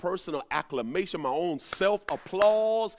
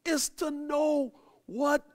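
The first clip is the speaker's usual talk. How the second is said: rising preaching fervor; 7 semitones higher than usual. Real, not acted.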